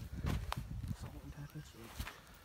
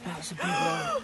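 much higher, thrilled exclaim; excited; slower than usual. Real, not acted. Dad sees the huge fish surface, thrilled and high. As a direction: blurt it out, amazed at the size.

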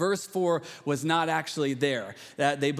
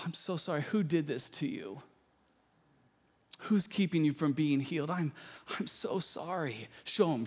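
put-on coddle him like a poor little boy; mock pitying coddler; 3 dB quieter than usual. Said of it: "Jesus did not go to this man and say".

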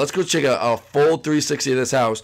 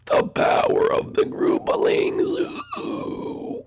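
high, slow, airy chant; whispery little character; 3 dB quieter, slower than usual. Pretend. Sidekick credits the groupalings, high whispery voice. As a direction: draw it out spookily into a soft ooh. Figure cheers the builders.

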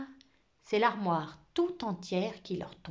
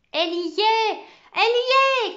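high, excited shouts, building louder; children crying out; 13 semitones higher, 9 dB louder than usual. A narrator's reading. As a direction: shout it out, higher the second time. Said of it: "crièrent Léonard et Cheryl". (cris aigus et excités d'enfants)

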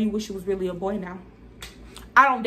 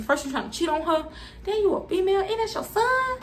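rising higher and higher in sassy disbelief; 7 semitones higher than usual. Retold or imitated. [name] to the liar, rising high sassy disbelief. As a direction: climb up the scale in sassy shock.

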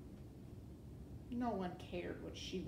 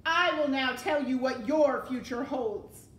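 loud, raised villain pronouncement; menacing proclamation; 4 semitones higher, 12 dB louder than usual. A narrator's reading. Rat sentencing the princess to the dungeon, loud and menacing. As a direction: boom it out as a threat.

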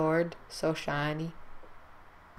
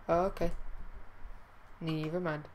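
usual speaking voice

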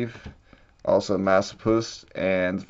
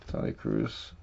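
softer, breathy under-the-breath repeat; whispered repeat; 12 semitones lower, 11 dB quieter than usual. Real, not acted. Repeating a card name to himself, whispered. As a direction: murmur the name to yourself.

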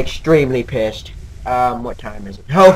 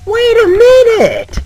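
high, slow, drawn-out falsetto "Wait a minute"; 9 dB louder, slower than usual. Acted. Streamer hamming up recognizing the place, high and drawn out. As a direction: stretch "minute" up high and long. Repeats phrase in different voices.

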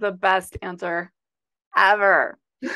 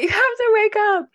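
high, louder insistent plea; mock pleading; 13 semitones higher, 7 dB louder than usual. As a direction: beg him to wake up, hammed-up. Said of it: "I was like".

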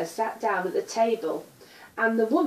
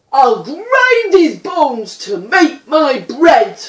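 loud, high booming giant's chant, slowing at the end; ogre roaring; 8 semitones higher, 15 dB louder than usual. A narrator's reading. Ogre roaring after Jack as he flees, loud and high. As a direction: bellow it like a hungry giant on the hunt. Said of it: "Behind him he could hear".

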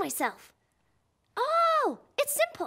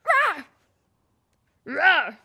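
monster roar; 3 semitones lower, 4 dB louder than usual. Shown as a demonstration. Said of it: "One, two, three".

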